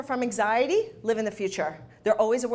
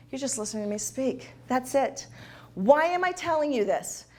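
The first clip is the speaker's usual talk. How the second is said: ordinary talk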